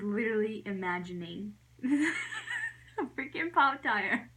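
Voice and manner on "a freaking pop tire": breaking into real laughter, pitch climbing; slow, cracking up at your own silliness; laughing in disbelief. Real, not acted. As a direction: push the words out through a laugh.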